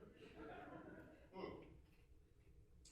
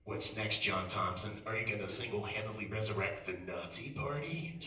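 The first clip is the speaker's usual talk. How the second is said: low, louder accusing ad voice; accusing attack ad; 4 semitones lower, 4 dB louder than usual. Retold or imitated. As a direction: hammer the rival like a smear ad. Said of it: "they jump to conclusions".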